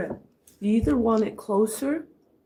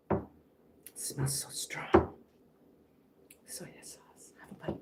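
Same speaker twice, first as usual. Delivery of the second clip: whisper; 7 semitones lower, 12 dB quieter than usual. Real, not acted. Whispering while tasting.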